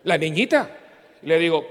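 usual speaking voice

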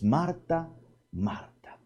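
soft, fading loving call of a name; tender calling. Acted. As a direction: call her gently, with tenderness. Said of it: "así con aquel amor".

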